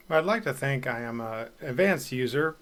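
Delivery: ordinary talk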